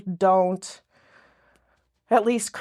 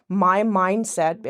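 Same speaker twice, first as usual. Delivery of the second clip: usual speaking voice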